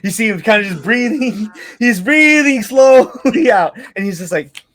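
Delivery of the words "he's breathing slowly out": slow, louder, higher stretched words; drawn-out. A narrator's reading. Game master describing the character calming down, drawn-out. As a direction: stretch it out like a long exhale.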